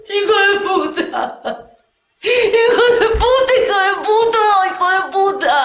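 very high, loud wailing through tears; sobbing rage. Acted. Betrayed woman wailing at the lying man. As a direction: scream it out, voice cracking with sobs.

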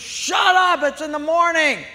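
high, loud shout; angry yell. Acted. Neighbor yelling it's too early. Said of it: "The guy goes".

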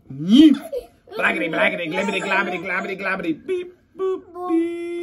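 high nonsense babble, beep boop sung; high goofy gibberish; silly sounds. Acted. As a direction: babble like a cartoon robot. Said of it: "make silly sounds like".

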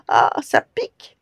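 high, loud ouch of pain; pained yelp. Acted. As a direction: yelp as the ferns prick. Giant lies on prickly ferns.

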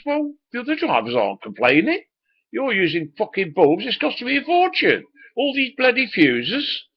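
high, loud exasperated boss voice; boss ranting. Retold or imitated. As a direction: play the boss blowing his top over the costs. Boss rants about costs.

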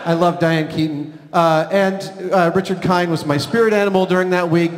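normal talking voice

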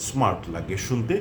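ordinary talk, nothing marked

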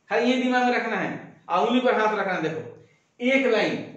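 ordinary talk

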